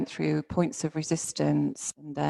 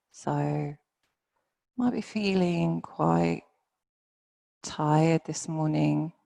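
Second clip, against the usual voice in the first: guided meditation. Real, not acted. Yoga teacher settling students into their bodies, guided meditation. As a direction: ease them down gently, phrase by phrase.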